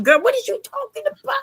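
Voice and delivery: high, disbelieving question; can't believe what she heard; incredulous. Real, not acted. Incredulous at friend's claim.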